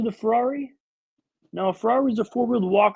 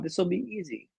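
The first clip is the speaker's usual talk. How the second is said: softer repeat, trailing; murmured to himself, confident; quieter echo; 2 semitones lower, 9 dB quieter, slower than usual. Retold or imitated. Patient reassuring himself about the splint, quiet. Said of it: "I thought".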